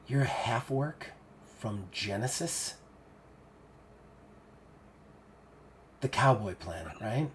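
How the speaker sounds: hushed, halting, dawning realization; wary Siggy. Acted. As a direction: let it sink in softly, with pauses.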